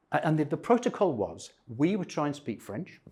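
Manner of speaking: usual speaking voice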